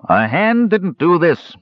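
high-pitched, drawn-out guard's voice. A narrator's reading. Guard telling others what killed the man, high character voice. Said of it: "said another guard".

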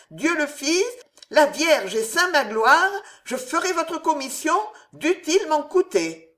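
high, loud, resolute oath; firm vow. A narrator's reading. Old devout landlady swearing to help Jeanne, firm and loud. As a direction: swear it loudly, with conviction. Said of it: "dit la dévote avec fermeté".

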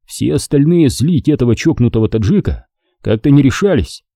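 ordinary delivery

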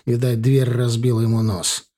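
ordinary delivery, nothing marked

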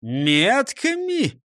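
slow, high, drawn-out question; stretched, incredulous cry; astonished exclamation. A narrator's reading. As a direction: echo the word in amazement. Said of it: "хором воскликнули шеф и Ниса Чих".

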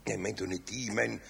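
ordinary delivery

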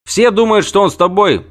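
high, loud, heated telling-off; the wounded knight scolds Ciri about the scandal she caused; agitated rant. Acted. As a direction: pour it out in an indignant rush. Freixenet ranting.

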